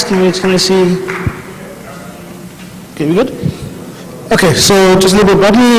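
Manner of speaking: ordinary talk, nothing marked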